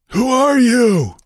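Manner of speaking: loud, slow, high pirate roar. A narrator's reading. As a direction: bellow it like a pirate mob. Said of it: "they roared".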